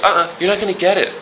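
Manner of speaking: playful stern refusal. Pretend. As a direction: wag a finger as God.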